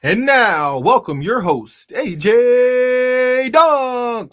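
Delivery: loud, hyped intro with the host's name dragged out; announcer welcome. Acted. Announcer welcoming the host to the stage, big drawn-out hype. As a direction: stretch the name like a ring announcer.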